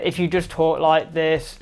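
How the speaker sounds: slow, flat drone; boring monotone. Shown as a demonstration. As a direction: drag it out with no pitch movement. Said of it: "sound really boring".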